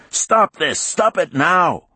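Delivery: high, loud desperate shout; angry shout. Acted. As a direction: yell it, pounding the glass. Man pounds glass, demands stop.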